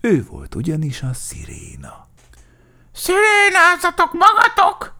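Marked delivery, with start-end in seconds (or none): 2.9-4.9 very high, loud screech; furious siren-like squawk; angry. A narrator's reading. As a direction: shriek it like an irate crow. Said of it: "mondta dühösen".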